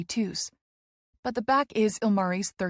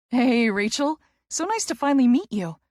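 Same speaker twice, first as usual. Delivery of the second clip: 1 bright greeting; 3 semitones higher, 4 dB louder than usual. A narrator's reading. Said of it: "She laughs".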